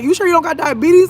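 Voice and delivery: high, incredulous mock doctor voice; squeaky doubting imitation of the doctor. Patient mocking her doctor's doubting question in a high voice. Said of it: "he was like".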